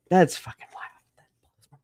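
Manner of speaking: slow whispered aside. Real, not acted. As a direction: whisper it in disbelief. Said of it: "whispered aside".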